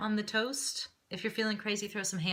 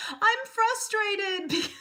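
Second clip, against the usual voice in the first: high, loud, drawn-out mock toddler wail; upset young son; 14 semitones higher, 6 dB louder, much slower than usual. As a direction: imitate an upset little boy, stretching it out. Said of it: "says now when he's upset, he goes".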